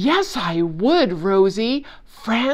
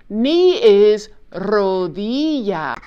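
slow careful repeat; 4 dB louder, slower than usual. Acted. Puppet repeats the word slowly.

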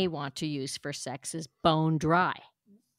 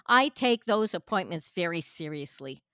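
ordinary talk

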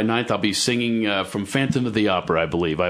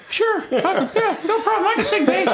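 much higher squeaky falsetto; helium voice. Retold or imitated. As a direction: talk as if on helium. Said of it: "Footloose on helium".

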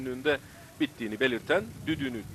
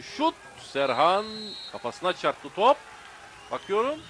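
excited commentator; 7 semitones higher, 3 dB louder than usual. Real, not acted. Shot and handball scramble.

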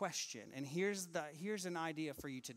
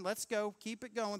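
higher, louder queue-herding call; mock security line worker hurrying people; Peter as line attendant; 5 semitones higher, 5 dB louder than usual. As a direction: push the line along impatiently. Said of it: "like he's at the amusement park".

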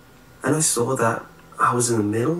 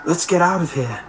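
high-pitched, eager urging; raised, hurried-sounding exclamation; excited cry; 7 semitones higher, 3 dB louder than usual. A narrator's reading. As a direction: call out eagerly to escape. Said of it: "cried Jeremy".

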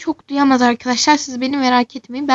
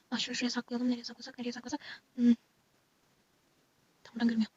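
hushed; 17 dB quieter, much faster than usual. Acted. Hiding the camera secretly.

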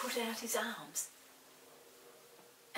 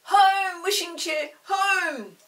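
loud, high command; crying out; 8 semitones higher, 12 dB louder, much slower than usual. A narrator's reading. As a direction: call the chair home. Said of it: "cried Chinky".

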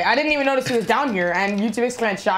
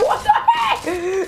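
high, slow laughing what the heck; retold disbelief, high and drawn out; mock shock; 13 semitones higher, much slower than usual. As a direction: stretch it out, laughing. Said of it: "I was like".

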